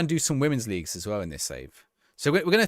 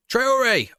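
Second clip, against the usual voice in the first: excited shout; 11 semitones higher, 6 dB louder than usual. Real, not acted. Shot hits the post.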